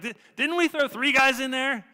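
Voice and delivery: high, loud, disbelieving cry; shocked king. Retold or imitated. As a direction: shout it in amazed disbelief.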